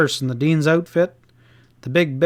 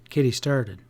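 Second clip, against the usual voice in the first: plain reading voice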